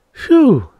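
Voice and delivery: high, loud exhaled exclamation; shaken relief. A narrator's reading. Woman alone after the Devi vanishes, shakily relieved. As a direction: let out a mildly shaken phew. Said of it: "Miss Twilly said shakily".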